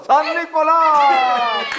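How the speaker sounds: long drawn-out call; grand announcement. Acted. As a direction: call out the name, stretching it slowly. Anuncia a Santa, vocal alargada. (anuncio alargado y festivo)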